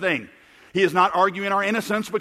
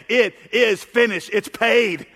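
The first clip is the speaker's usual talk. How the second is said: raised, emphatic proclamation, a little higher; loud voice; 4 semitones higher, 4 dB louder, slower than usual. Retold or imitated. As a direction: declare it out slowly like a victory cry. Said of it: "with a loud voice said".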